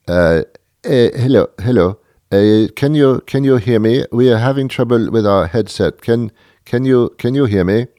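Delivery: imitating a telemarketer in a thick Indian accent; light send-up of a scam caller with an Indian accent. Scam telemarketer on a bad headset, thick Indian accent. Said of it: "in a very thick Indian accent".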